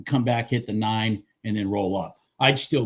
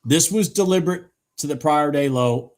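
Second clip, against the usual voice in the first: deliberate pressed harder than the rest of the line; stress on deliberate; 2 semitones higher, 4 dB louder than usual. Shown as a demonstration. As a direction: hit the word deliberate. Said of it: "I wanna emphasize the word deliberate".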